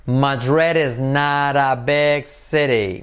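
slow, with "not" said as "nar"; slow model sentence. Shown as a demonstration. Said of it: "Not se convierte en un R suave".

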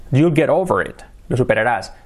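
usual speaking voice

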